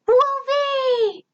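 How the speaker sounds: high, delighted squeal of a name; excited robot shout. A narrator's reading. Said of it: "he shouted and jumped to hug me".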